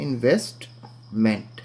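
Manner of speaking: इन्वेस्टमेंट split as "in-vest, ment"; syllable by syllable. Shown as a demonstration. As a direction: pause before the last syllable of इन्वेस्टमेंट. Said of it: "उसके syllables बताता हूँ आपको". (इन्वेस्टमेंट को टुकड़ों में बोलते हुए)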